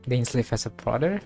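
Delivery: ordinary talk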